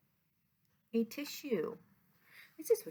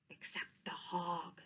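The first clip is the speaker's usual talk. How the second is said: whisper; 5 semitones lower, 16 dB quieter than usual. A narrator's reading. Hog awake, bedtime story.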